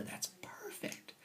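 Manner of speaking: hushed, slow, gleeful whisper; whispered relish. Acted. Teacher whispering to class how perfect the scheme is. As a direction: savour it in a sly whisper.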